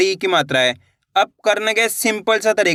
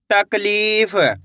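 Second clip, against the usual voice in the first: slow blending; much slower than usual. Shown as a demonstration. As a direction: draw the word out slowly, syllable by syllable. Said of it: "इसको इस तरीके से पढ़ लेना है". (तकलीफ को बहुत धीरे खींचकर बोलें)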